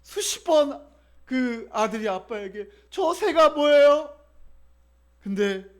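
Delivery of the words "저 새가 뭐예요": put-on high, loud, slow childlike question; child asking. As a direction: ask eagerly like a small boy. Child keeps asking father.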